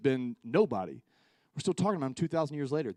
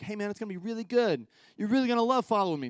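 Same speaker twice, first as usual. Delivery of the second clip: higher, fast, pushy sweet-talk; coaxing sales pitch; 7 semitones higher, 3 dB louder, faster than usual. Retold or imitated. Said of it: "no coercing".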